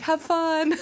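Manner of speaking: high, breezy mock farewell. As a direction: toss it off lightly, high and loud. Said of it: "He's like".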